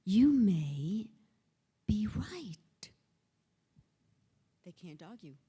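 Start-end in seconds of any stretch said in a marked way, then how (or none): 0.1-2.6 slow, with a long pause before "be right"; deliberate delivery. Shown as a demonstration. Repeating the four words to end an argument, deliberately slow. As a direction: stretch "You may", pause, then finish gently. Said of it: "I'll say that again".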